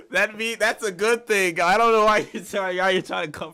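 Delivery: high, loud, worked-up objection; amused protest. Real, not acted. Streamer objecting to chat trying to comfort him, amused protest. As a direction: raise the voice in laughing indignation.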